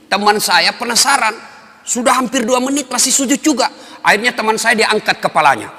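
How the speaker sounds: ordinary talk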